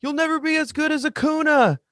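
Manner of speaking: mock a bratty kid heckling from the stands. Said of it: "he's just yelling".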